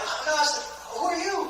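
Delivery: high-pitched, giddy retold surprise; excited greeting. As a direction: act out a thrilled, high greeting. Acted excited greeting from a fan.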